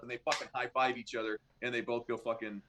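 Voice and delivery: usual speaking voice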